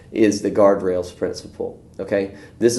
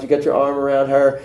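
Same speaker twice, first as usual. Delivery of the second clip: ordinary talk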